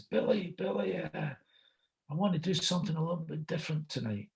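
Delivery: high, soft imitation of a film star; Al Pacino. Star turns to the host with a request, high imitated voice. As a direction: call out gently in a high put-on voice. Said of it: "Pacino turns to me and he says".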